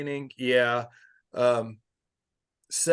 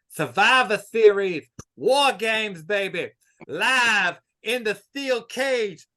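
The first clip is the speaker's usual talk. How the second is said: hype promo; 7 semitones higher than usual. Acted. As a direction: sell the match like a ringside announcer. Hyping War Games like promo.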